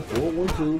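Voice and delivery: usual speaking voice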